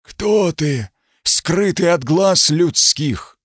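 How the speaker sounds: booming inhuman stone voice, high and slow; grinding stone statue. Acted. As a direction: speak like a living stone statue. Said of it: "Нечеловеческий голос спросил гулко".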